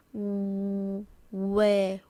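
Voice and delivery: long rounded W leading into WAY; WAY with a drawn-out W onset. Shown as a demonstration. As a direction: hold the W before opening into WAY. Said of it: "I do the w a bit more".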